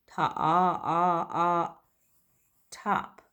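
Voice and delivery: "Ta, ah" pulled apart, then a high "top"; sounding out. Shown as a demonstration. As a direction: break the word into its sounds. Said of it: "has the O sound".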